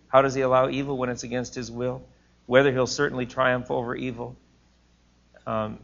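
ordinary delivery

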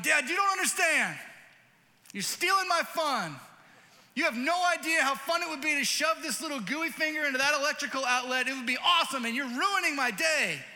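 put-on high, loud whiny child yelling; mock toddler tantrum, shouted high; screaming toddler. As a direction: rant like a furious little kid robbed of fun. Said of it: "screaming at me going".

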